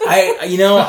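high, loud laughing talk. Real, not acted. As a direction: laugh through the words. Laughing at being teased.

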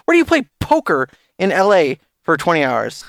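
high-pitched, scoffing disbelief; incredulous challenge. Real, not acted. Podcaster doubting the streamer's poker story, incredulous. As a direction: climb high as you question the alibi.

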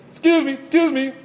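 high, polite excuse me, excuse me; a sweet courteous honk in words. Retold or imitated. Driver politely honking at the truck, high and polite. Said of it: "what I mean to say is".

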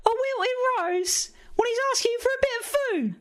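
Cockney crow, high and laughing; outraged Cockney bird, cracking up; Cockney accent. Acted. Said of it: "my Cockney accent".

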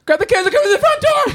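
laughing mock panic; Anchorman quote. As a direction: squeal the alarm through a laugh. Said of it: "Have you guys seen that?".

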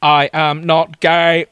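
slow, loud, stilted Dalek proclamation; robotic alien wooing, at full volume. Acted. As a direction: declaim each word like a Dalek. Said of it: "I specialise in Dalek voices".

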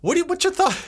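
high-pitched, about to crack up; trying not to laugh. Real, not acted. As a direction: squeak it out before the laugh escapes. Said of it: "can't say what's your thoughts without laughing".

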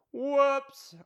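high, sing-song whoops; mock whoops. Shrugging off getting fired with a high mock whoops.

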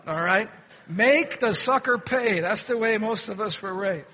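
ordinary talk, nothing marked

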